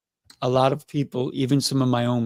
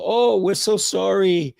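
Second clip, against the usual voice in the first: high, slow apology; apologetic family voice; 7 semitones higher, slower than usual. Retold or imitated. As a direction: say sorry like a guilty relative.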